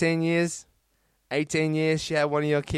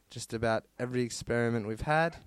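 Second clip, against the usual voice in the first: ordinary talk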